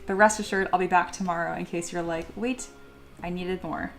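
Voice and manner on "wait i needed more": normal talking voice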